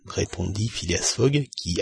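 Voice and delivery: ordinary delivery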